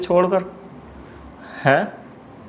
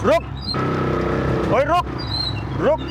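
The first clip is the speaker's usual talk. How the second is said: calling out to stop; 6 semitones higher than usual. Acted. Husband chasing the AC repairman, shouting at him to stop. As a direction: yell after someone running off.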